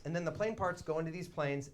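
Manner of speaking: ordinary delivery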